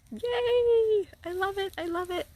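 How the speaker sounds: high squealing yay; excited cheer. Real, not acted. Cheering at palm tree.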